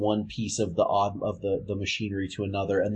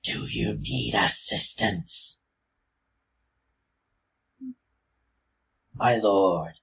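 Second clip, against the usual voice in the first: slow, hushed and raspy guard; quiet raspy voice; slower than usual. A narrator's reading. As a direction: rasp it out quietly. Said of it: "in a quiet, raspy voice".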